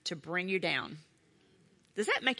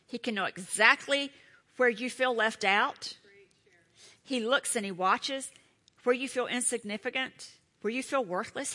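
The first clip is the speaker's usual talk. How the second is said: usual speaking voice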